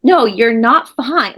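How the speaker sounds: slow, deliberate no; slow firm denial. Real, not acted. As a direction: spell out firmly that she is not fine. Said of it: "don't have to trick your mind".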